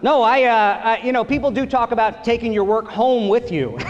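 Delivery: usual speaking voice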